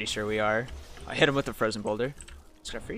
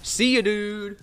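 much higher, louder mocking goodbye; hammed-up taunt; 10 semitones higher, 6 dB louder, slower than usual. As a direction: stretch the ya, gloating. Repeats taunt, bigger.

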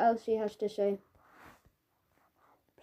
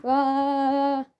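loud, higher mock roar; playful roar; 4 semitones higher, 6 dB louder than usual. Kids spinning in drama warm-up, mock roar. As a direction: let out a silly monster rah.

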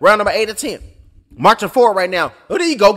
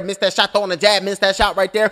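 usual speaking voice

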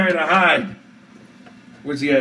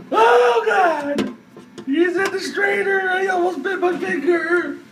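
high frightened shouting; panicked shrieking; 13 semitones higher than usual. Real, not acted. Panics as the spider moves.

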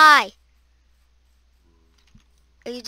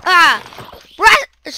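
ordinary delivery

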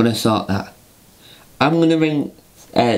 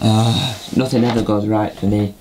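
normal talking voice

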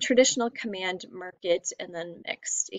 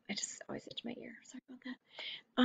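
soft unvoiced whisper; 3 semitones higher, 13 dB quieter than usual. Real, not acted. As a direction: whisper the apology aside. Apologising aside for itching.

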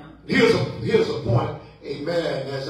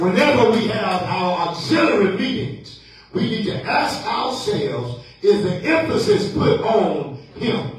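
measured pulpit cadence with stressed key words; preaching refrain; 6 semitones higher, 4 dB louder than usual. Acted. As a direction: put the challenge to the congregation.

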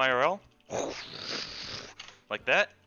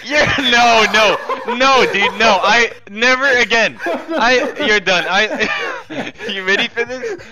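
high, loud laughing outcry; laughing protest; 8 semitones higher, 8 dB louder, slower than usual. Real, not acted. Laughing after being killed.

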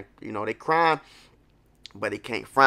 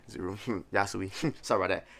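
laughing; 5 semitones lower, 6 dB quieter than usual. Real, not acted. Laughing at own slip.

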